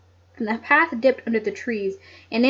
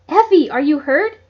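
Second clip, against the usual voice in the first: cried out; 5 semitones higher, 7 dB louder than usual. A narrator's reading. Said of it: "Nancy cried".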